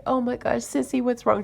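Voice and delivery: a hint of a concerned kid asking; worried child. Little girl voiced worried.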